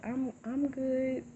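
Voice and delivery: high, slow, voice on the edge of breaking; a brave fine that is about to crack; holding back tears. Retold or imitated. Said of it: "And I was like".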